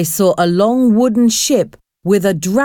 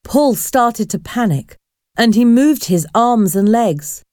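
plain reading voice

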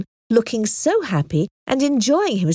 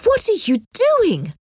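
high, appalled question; scandalised, high-pitched protest; shocked cry; 11 semitones higher than usual. A narrator's reading. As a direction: demand it in shock, pitch leaping up. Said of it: "she cried".

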